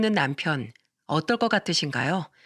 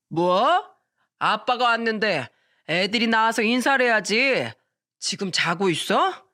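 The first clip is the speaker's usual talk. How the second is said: put-on drunk husband; 2 semitones higher than usual.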